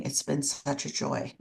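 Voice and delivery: dry, sarcastic "such a joy". Real, not acted. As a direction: say it deadpan, meaning the opposite. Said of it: "I mean that very sarcastically".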